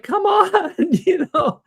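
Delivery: high-pitched mock plea; retold pleading. Young artist begging the secretary for a chance, pleading. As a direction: plead it high, playing up the desperation.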